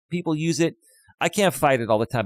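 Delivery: ordinary talk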